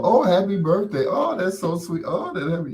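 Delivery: high, quick mock-sweet cooing; gushing well-wishers. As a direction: imitate a crowd of admiring commenters. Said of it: "Everybody else was like".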